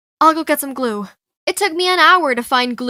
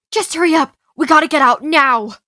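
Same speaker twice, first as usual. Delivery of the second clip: loud, urgent command; pressing, raised-voice urgency; 3 semitones higher, 6 dB louder than usual. Acted. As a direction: push her to get out this second. Urging escape from alarm.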